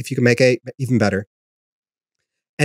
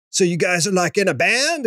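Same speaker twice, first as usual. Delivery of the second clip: stoner dude voice, pitched up; lightly voiced laid-back music store guy; a hint of the cartoon bus driver; Simpsons bus driver; 6 semitones higher than usual. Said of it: "talking like the bus driver from The Simpsons".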